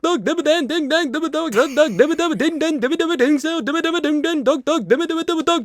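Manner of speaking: high wheezing cartoon chant; Doug Dimmadome voice. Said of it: "You have to stop".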